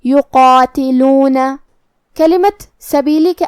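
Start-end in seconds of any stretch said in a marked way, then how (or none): 0.0-1.4 level drawn-out chant of یقاتلون, full qaa and long loo; heavy qaf. Shown as a demonstration. Teacher demonstrating heavy qaf and alif, slow tajweed recitation. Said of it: "دونوں کو بھاری آواز میں".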